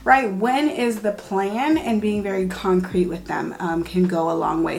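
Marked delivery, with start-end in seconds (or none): none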